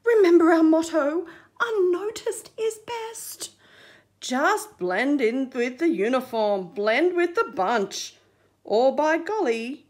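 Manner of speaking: lightly voiced stern crabs; warning crabs. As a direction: scold like a cautious elder. Crabs warn Fab.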